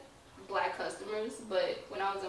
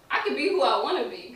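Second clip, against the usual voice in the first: louder, higher and bursting with pride; excited declaration; 5 semitones higher, 9 dB louder than usual. Real, not acted. To the interviewer, a proud excited claim. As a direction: proclaim it with energy.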